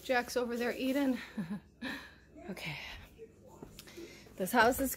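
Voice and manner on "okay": whispered laugh. Real, not acted. Whispered laugh, child nearby.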